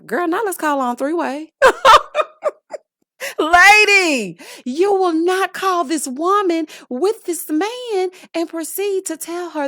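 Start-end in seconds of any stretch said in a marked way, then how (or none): 1.6-2.5 loud high cackle. Real, not acted. Laughs at absurd reality scene. 3.4-9.7 outraged cry. Real, not acted. Outraged reaction to TV scene.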